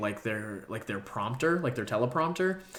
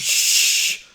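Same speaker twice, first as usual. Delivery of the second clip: voiceless shush; 7 dB louder than usual. Real, not acted. Friend shushing the storyteller, voiceless shush. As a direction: hush them, playfully. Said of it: "We gotta shh".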